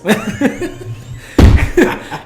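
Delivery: loud, high breathy laugh; loud burst of laughter. Real, not acted. Retelling the flight attendant story, bursting out laughing. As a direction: crack up at the memory.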